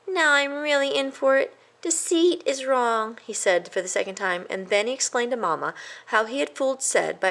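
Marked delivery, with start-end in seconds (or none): 0.1-3.2 worried mouse. A narrator's reading. A worried mouse dreads Mamma, high.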